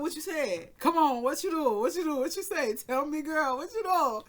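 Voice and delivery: high, loud, eager questions; excited pressing. Real, not acted. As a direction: egg her on, giddy. Pressing sister for the story.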